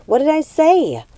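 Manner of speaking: a hurt sister calling after the one who stormed out; confused and hurt. Acted. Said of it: "Gothel was confused and hurt".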